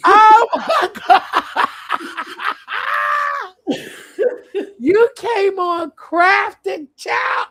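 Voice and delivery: shouting through tears of laughter; laughing hard. Real, not acted. Reacting to her friend's crafting, laughing shout. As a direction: lose it laughing.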